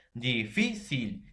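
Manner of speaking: slow "Difícil", split as di-FÍ-cil; stressed syllable-by-syllable. Shown as a demonstration. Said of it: "la mayor fuerza de voz en la penúltima sílaba".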